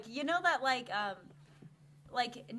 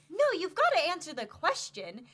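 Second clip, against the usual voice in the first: demanding; 6 semitones higher, 8 dB louder than usual. Acted. Bride demanding answer.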